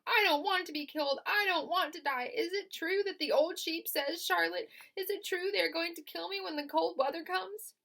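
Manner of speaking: high, loud, fast panic; panicked pleading. Acted. Wilbur pleading with Charlotte, high loud panic. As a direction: plead in a rush.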